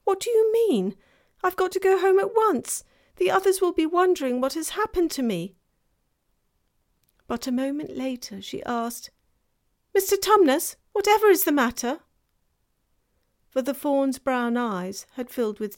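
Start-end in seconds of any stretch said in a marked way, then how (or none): 0.0-5.6 high, loud and fast, panicking; a girl rushing to get home; frightened. A narrator's reading. Lucy anxious to leave, high fast frightened voice. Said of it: "feeling rather frightened". 9.9-12.0 high, loud, fast and anxious; a girl alarmed by a friend's tears; worried. A narrator's reading. Lucy seeing the faun cry, high anxious voice.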